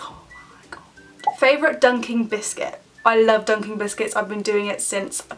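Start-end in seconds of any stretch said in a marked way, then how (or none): 0.0-1.4 breathy whispered aside, voice dropped away. Real, not acted. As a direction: whisper it to yourself in delight. Savouring honey roasted parsnips.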